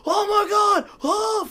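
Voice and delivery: high, loud panicked cry of Oh my God; panicked friend. Retold or imitated. Retelling a friend panicking at a fall, loud panicked cry. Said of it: "He's freaking out".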